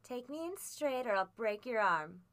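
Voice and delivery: loud, menacing sneer; bully the boy with open scorn; sneering threat. A narrator's reading. Blind man threatening the boy into obeying, loud sneer. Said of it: "he sneered".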